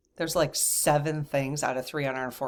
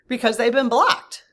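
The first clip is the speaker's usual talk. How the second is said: laughing; 8 semitones higher, 8 dB louder than usual. Real, not acted. Laughing repeat of her point.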